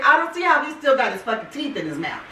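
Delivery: usual speaking voice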